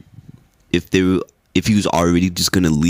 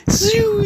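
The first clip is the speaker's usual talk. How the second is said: high-pitched whoosh of a zoom; zooming noise. Retold or imitated. Joking about a wrestler scooting off, high zooming noise. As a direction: imitate a quick, high zip away.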